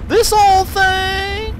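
very high, slow, loud disbelief; shocked high drawn-out question; incredulous. Real, not acted. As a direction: squeal it in disbelief.

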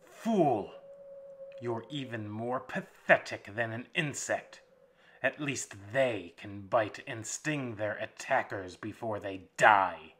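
loud contemptuous jeer, a bark on Fool; vampire mocks the kneeling prisoner, jeering. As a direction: sneer at the broken captive. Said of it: "the master jeered".

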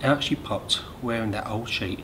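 ordinary delivery, nothing marked

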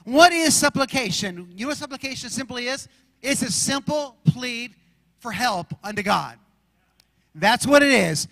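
preacher's cadence, quotes rolled out with weight; preaching. Acted. Pastor preaching on worry and supplication, fervent. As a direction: drive the scripture home to the church.

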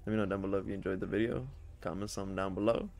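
quick YouTube outro read; rushed outro. Acted. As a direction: rattle off the subscribe plea.

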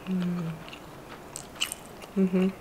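speaking while chewing; muffled closed-mouth hum; mouth full, chewing. Real, not acted. Seen 0: bit the roll at -3.0; cheeks full and lips closed throughout.

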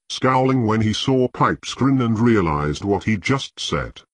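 ordinary delivery, nothing marked

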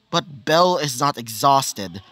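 usual speaking voice

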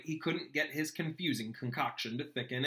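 plain reading voice

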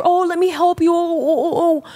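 high, syrupy, overly nice helper; mock sweet boyfriend. Boyfriend suddenly offering help, hammed-up high sweet voice. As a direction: lay the sudden niceness on thick. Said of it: "goes from being a complete dickhead to being a kind, nice per".

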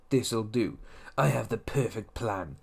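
low, hushed mock private eye; cartoon schemer's quiet, low voice; shady detective. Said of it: "looking like a shady, uh, detective".

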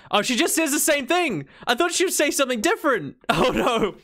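high-pitched, thrown-off exclamation; dismayed surprise. Real, not acted. Streamer finding Penny repeats her dialogue, dismayed.

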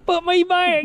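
high squeaky voice for the fish. Pretend. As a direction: speak as the caught fish, high. Fish speaking voice, playful.

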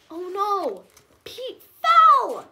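gasping dismay, loud and high; alarmed exclamation. A narrator's reading. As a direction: cry it out as if watching him fall. Narrator reacts to the fall.

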